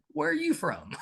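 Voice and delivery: high, curious mock question; a lightly put-on voice of a puzzled listener; mimicking people asking. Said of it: "I was asked over and over".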